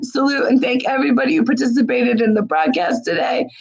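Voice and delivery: ordinary talk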